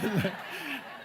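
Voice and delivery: risa espontánea y aguda; ríete de tu propia anécdota. Real, not acted. (carcajada real en medio del relato)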